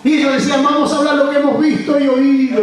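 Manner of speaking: soaring revival preacher at full power; full-force preaching. Acted. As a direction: lift the congregation with a fiery preaching chant.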